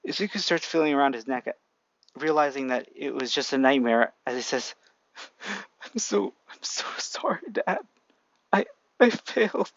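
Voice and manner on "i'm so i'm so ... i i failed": high, whispered sobbing apology; crying apology. A narrator's reading. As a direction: whisper it through tears.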